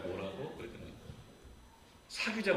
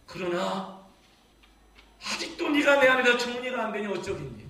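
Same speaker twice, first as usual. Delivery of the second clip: ordinary delivery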